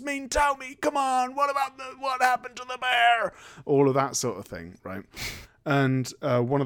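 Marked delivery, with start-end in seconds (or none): 0.0-3.3 high, pleading hammed-up demand; father begging the homeless people in a high desperate mock voice; desperate Cage. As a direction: beg frantically for answers. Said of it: "He's like".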